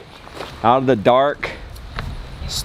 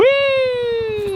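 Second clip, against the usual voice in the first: playful cheer. Real, not acted. Cheering the birthday boy.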